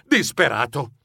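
plain reading voice

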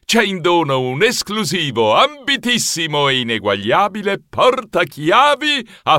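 grand prize announcement, swelling; theatrical announcer, stretched high finish; butler as showman. Acted. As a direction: sell every superlative with ceremony.

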